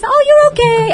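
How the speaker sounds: put-on very high, loud cooing baby talk; little baby voice. Shown as a demonstration. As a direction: coo at the dog in baby talk. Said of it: "in a little baby voice".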